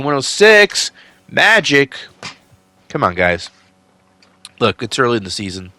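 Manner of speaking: ordinary talk, nothing marked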